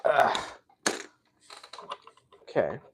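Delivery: usual speaking voice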